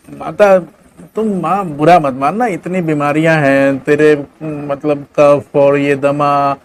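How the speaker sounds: a selfish son squirming out of it; dismayed son. Acted. Son refusing mother.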